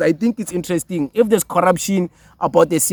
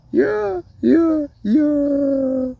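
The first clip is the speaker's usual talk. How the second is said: laughing high yeahs; 10 semitones higher, much slower than usual. Real, not acted. Laughing after the clip.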